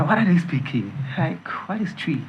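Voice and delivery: confused. Retold or imitated. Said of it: "I felt like the language was".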